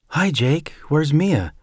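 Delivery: lightly voiced friendly sister-in-law; Janet's voice. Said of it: "Janet came out of the kitchen with a smile".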